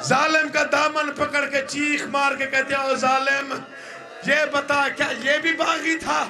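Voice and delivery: high, crying lament with shouted lines; mourning preacher crying out the tragedy in full force; impassioned masaib recitation. Acted. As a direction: wail the mother's plea to the gathering.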